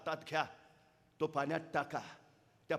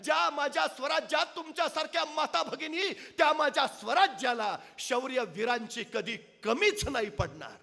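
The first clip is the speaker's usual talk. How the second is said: high, loud proud proclamation; king shouting praise of brave women; rousing royal shout; 11 semitones higher, 9 dB louder than usual. Acted. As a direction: leap up and hail them. ताडकन उठले राजे.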